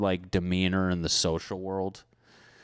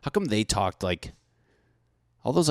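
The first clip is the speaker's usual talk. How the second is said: normal talking voice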